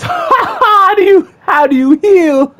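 high, slow laughing disbelief; laughing frustration. Real, not acted. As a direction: laugh it out in exasperation.

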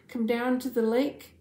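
contained shout to passers-by; mild shout. A narrator's reading. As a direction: call out to the neighbours, storybook style. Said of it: "they shouted to anyone who would listen".

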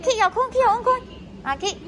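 ordinary talk

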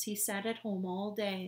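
ordinary delivery, nothing marked

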